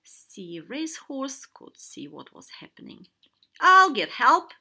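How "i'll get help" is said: high, very loud call; loud, high shout to the doctor. A narrator's reading. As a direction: call urgently across the water. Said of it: "he called to Doctor Dean".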